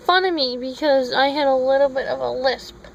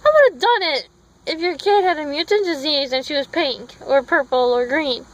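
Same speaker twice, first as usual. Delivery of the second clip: ordinary delivery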